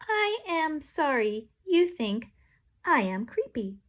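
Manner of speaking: slow, even machine voice; a lightly voiced gadget; talking toilet seat. A narrator's reading. Toilet seat apologising to the lady, slow robotic. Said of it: "said Party Pooper".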